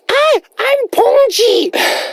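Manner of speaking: very high, drawn-out squeal; slow, squeaky put-on falsetto; squeaky cartoon character. Introduces himself in put-on voice.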